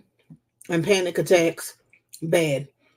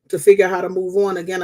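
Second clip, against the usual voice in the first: normal talking voice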